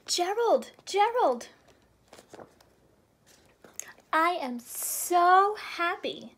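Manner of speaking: high, loud, bouncy little pig voice; excited Piggie. Acted. Pig calling her friend, bursting with joy. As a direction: squeal it out like an overjoyed pig.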